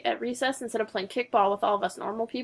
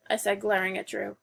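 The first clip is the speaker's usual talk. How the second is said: plain reading voice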